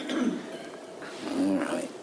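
very soft, low, grudging mumble; reluctant assent. Acted. Jim gives in to God's order, reluctantly. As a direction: give in under your breath.

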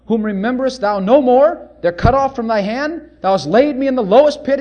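loud preached lament; fervent scripture lament; psalm reading. Acted. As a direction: proclaim the psalm like a sufferer crying out.

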